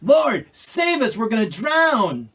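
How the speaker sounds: high, loud, desperate cry; panicked shout. Acted. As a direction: yell it like the boat is sinking. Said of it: "the disciples shout at him".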